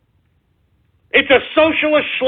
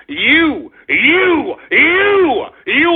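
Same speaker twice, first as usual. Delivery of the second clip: repeated you climbing higher and louder; building angry shout; 5 semitones higher, 3 dB louder, much slower than usual. Real, not acted. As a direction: start plain and rise to an outraged yell. Stammering accusation at Canadians.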